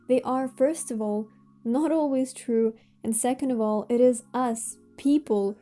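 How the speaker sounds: ordinary talk, nothing marked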